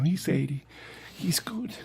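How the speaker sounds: soft hushed whisper; whispered aside. Retold or imitated. Fan whispering about the old comic, hushed. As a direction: whisper it behind a hand.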